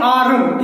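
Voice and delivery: "आरम" with the R sounded; wrong pronunciation. Shown as a demonstration. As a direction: say "आरम" in two syllables, voicing the R. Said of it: "ये आरम नहीं है". ("आरम" में र का उच्चारण)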